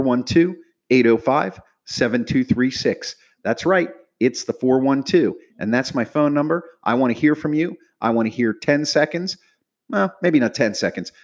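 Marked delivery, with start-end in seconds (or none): none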